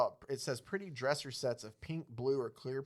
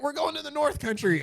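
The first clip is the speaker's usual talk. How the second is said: jolly Santa voice; 14 semitones higher, 6 dB louder than usual. Acted. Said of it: "Santa's like".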